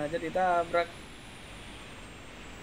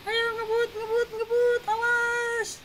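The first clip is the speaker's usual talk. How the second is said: a squeaky, much higher character voice urging speed; much higher put-on voice. Voicing a game character who urges the others to speed off, in a much higher put-on voice. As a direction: pitch way up like a panicky little figure.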